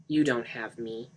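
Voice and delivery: ordinary delivery, nothing marked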